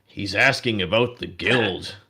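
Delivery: the orc calls out, loud and amused; mocking orc. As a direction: orc calls across the tavern to his buddy, loud and mocking. Orc voices laughing mockery.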